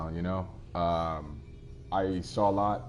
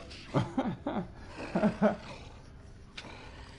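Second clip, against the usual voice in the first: long breathy laugh; 13 semitones higher than usual. Real, not acted. Still laughing at the joke.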